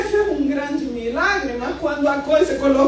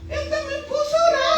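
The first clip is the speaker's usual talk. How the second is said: eager husband; 12 semitones higher, 4 dB quieter, faster than usual. Retold or imitated. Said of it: "Aí o João me viu".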